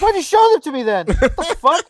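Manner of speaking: loud, high-pitched indignant rant; mock furious at the vendor; replaying the fight with the vendor, loud and high; retold angry outburst. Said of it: "I was so mad".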